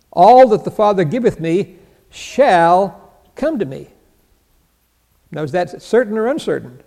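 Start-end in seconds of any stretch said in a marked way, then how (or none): 0.1-3.9 drawn out slowly, word by word; slow it right down for weight; slow emphatic quote. Shown as a demonstration. Preacher reading the verse slowly for emphasis. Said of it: "doctrine of election".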